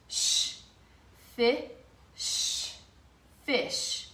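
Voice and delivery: "fi-" then a hissed "sh", then "fish"; sound it out. Shown as a demonstration. Teacher sounding out fish for children. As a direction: build "fish" piece by piece. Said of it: "these words end in the S H".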